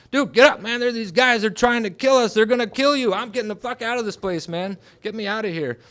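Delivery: high, loud alarm easing in volume; urgent shouting. Retold or imitated. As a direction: shout to rouse a sleeping friend, desperate to flee. Retold waking of friend in panic.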